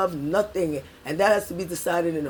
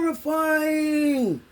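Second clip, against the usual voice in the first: drawn-out, higher horrified wail; very slow, higher cry of horror; 6 semitones higher, much slower than usual. Real, not acted. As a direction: let horrifying stretch in dismay. Stretched cry of disgust.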